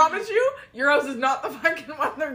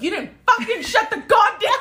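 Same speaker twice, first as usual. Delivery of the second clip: high, loud comic outburst breaking into laughter; mock angry yelling; 9 semitones higher, 5 dB louder than usual. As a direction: play at chewing someone out. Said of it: "no one to yell at to be like".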